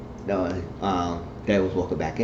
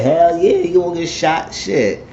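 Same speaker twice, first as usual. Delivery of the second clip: hype, high and loud cheer; pumped-up shout of approval; excited exclamation; 9 semitones higher, 5 dB louder, slower than usual. Retold or imitated. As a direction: cheer it on, pitched way up. Narrator quotes own excited reaction.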